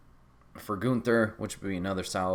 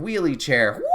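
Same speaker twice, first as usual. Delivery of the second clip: excited shout; 5 semitones higher, 7 dB louder than usual. Real, not acted. Excited about chair spot.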